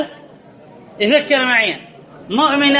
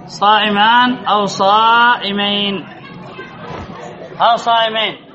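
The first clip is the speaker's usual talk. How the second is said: slow dictation; 3 semitones lower than usual. Shown as a demonstration. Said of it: "teacher repeats for dictation".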